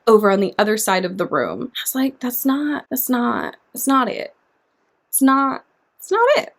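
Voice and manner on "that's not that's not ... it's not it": quiet disapproval. Retold or imitated. Acting out a disapproving reaction.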